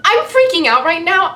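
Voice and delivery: high, loud, breathless excitement; gushing fan. Retold or imitated. Fan breaking down in front of actor, excited. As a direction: burst out with the confession. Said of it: "And then I broke".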